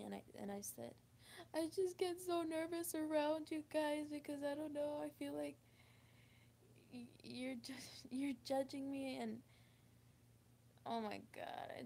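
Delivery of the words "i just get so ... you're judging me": higher, halting retold admission fading to a breath; a touch of shaky nerves, trailing into a half-laugh; nervous confession. Telling her friend's family she feels judged, lightly nervous. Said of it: "and I started stuttering".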